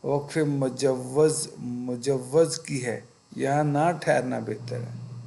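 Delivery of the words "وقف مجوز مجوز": "مجوز" said very slowly, syllable by syllable; "مجوز" slowly and carefully; slow term. Shown as a demonstration. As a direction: spell out "مجوز" slowly for students. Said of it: "زے کی علامت".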